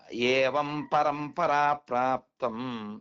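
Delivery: slow, drawn-out scriptural chant; solemnly intoned quotation; Sanskrit verse recitation. Acted. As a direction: recite it syllable by syllable, stretching each sound.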